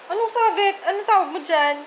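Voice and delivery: high, loud mock confusion; teasing puzzled question; playful disbelief. Real, not acted. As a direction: pretend not to know the word. Mock confusion over hanger.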